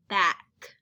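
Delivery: slow back, leaning on the final k; stretched back. Shown as a demonstration. Teacher to class, modeling the word back. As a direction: land hard on the k of back. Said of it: "What is the final sound in the word".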